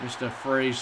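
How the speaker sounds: ordinary talk, nothing marked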